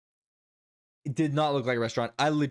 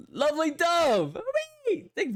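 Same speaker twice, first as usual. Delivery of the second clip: excited shout-out; 3 dB louder than usual. Real, not acted. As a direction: sing out the follower's name. Calling out a new follower.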